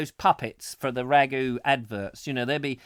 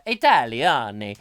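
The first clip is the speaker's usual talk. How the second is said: mock Italian; 4 semitones lower, 5 dB louder than usual. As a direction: put on a playful Italian accent. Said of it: "in Italian".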